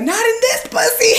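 high, loud mock retort; retorting to mom. Answering her mother's baby talk with a high loud retort. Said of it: "And I was like".

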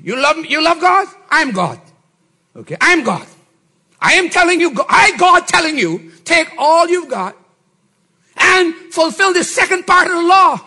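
loud, high commanding voice of God; stern divine command, loud and high; God's demand. Acted. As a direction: boom out God's demand to give it all away.

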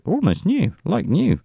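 mock proud boast. Commentator mimicking a proud airline source, mock boasting. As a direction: imitate someone proudly talking up used goods. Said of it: "He's very proud of that".

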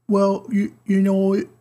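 N=Taika Waititi/public; attempted Taika accent. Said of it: "I can't do this accent".

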